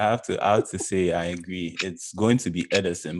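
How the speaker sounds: ordinary talk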